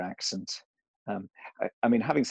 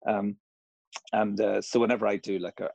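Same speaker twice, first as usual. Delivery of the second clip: ordinary delivery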